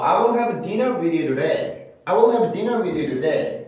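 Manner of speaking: "today" with a quick tap for the T; flapped T in today. Shown as a demonstration. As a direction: run "you today" together like "you-duh-day". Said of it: "이렇게 발음하는 경우도".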